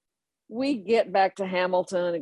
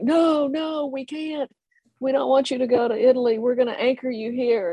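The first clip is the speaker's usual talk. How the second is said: much higher, fast excited protest; drunken pleading; 7 semitones higher than usual. Retold or imitated. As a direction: plead like tipsy young airmen. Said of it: "they're like".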